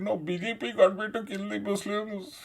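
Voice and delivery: high-pitched fake wailing through laughter; hammed-up tearful whine; mock crying. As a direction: imitate a man blubbering, pitch climbing high. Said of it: "He started crying".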